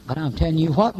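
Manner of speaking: ordinary delivery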